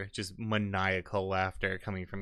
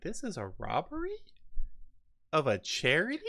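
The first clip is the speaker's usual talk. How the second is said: high, incredulous squeak of disbelief; shocked question; 7 semitones higher than usual. Acted. Dwarf outraged at a charity robbery, high incredulous question. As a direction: ask it like you can't believe your ears.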